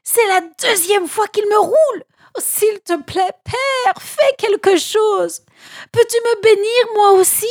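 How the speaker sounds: supplication désespérée, très aiguë; désespoir. A narrator's reading. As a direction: supplie ton père d'une voix haut perchée. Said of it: "s'écria-t-il désespéré". (fils trahi qui implore, très aigu)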